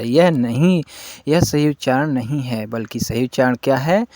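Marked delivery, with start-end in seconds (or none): none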